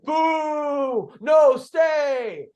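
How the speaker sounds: high mock crowd shout of boo. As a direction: call out like a disappointed crowd. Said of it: "the crowd's like".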